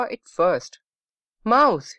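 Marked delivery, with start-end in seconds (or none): 1.4-1.9 higher-pitched alarmed exclamation; a touch of panic, lightly acted; mild scream. A narrator's reading. Grandma spots a mouse in the house, mildly screamed. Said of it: "she screamed".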